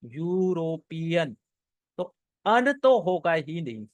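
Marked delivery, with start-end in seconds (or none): 0.0-1.4 "यूरोपियन" drawn out slowly, starting on a y sound; pronunciation shown. Shown as a demonstration. Teacher showing why "an" is wrong, "यूरोपियन" slowly sounded out. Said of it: "इसका pronunciation जो है".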